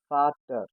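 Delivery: Vater repeated as "FAH-ter"; German pronunciation. Shown as a demonstration. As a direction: start Vater with an f. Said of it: "sounds as F".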